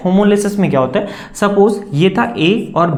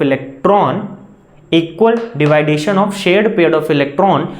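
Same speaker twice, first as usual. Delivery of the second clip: very slow, drawn-out dictation; slow definition, stretched for copying; dictating; much slower than usual. Real, not acted. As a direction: dictate it phrase by phrase for note-takers.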